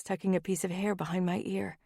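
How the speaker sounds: ordinary delivery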